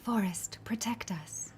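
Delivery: whispered, airy game-character voice; breathy Lauma. Shown as a demonstration. Actor showing a game character's voice to colleague, breathy and whispered. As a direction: breathe out each word, hushed and delicate. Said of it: "breathe as much as possible".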